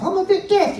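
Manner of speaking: high squeaky puppet voice; Minnie Paul. Acted. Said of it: "he's a bit deaf".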